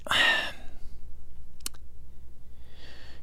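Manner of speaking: long, soft exhaled sigh. Real, not acted. Host pausing before introducing the clip, long sigh. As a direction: let out a long, weary breath.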